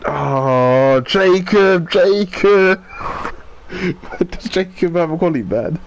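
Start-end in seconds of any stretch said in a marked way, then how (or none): 0.1-4.3 loud, high, laughing disbelief; shocked laughter. Real, not acted. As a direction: call his name through shocked laughs. Driver crashes live.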